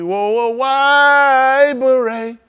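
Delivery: very slow, stretched-out high whoa; mock surprise. Real, not acted. Teasing a viewer about a beret, slow and high. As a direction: drag it out in fake shock.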